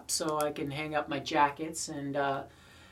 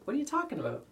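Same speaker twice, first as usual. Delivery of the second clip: confused American; 11 semitones higher, 4 dB quieter, faster than usual. Retold or imitated. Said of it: "they're like".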